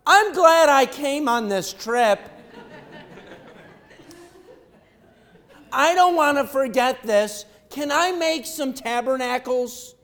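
high, loud and laughing with delight; giddy Peter. Retold or imitated. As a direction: gush like a thrilled kid on a trip. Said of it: "And Peter's like".

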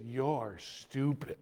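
slow, sweet put-down; sugary slow insult; sweetly. Retold or imitated. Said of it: "looks at him sweetly".

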